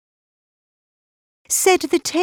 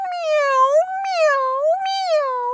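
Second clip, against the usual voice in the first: high, soft mewing; three tiny high-pitched cat mews; plaintive kitten meows; 4 dB quieter, much slower than usual. A narrator's reading. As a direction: mew like a little kitten. Kittens mew at the scolding.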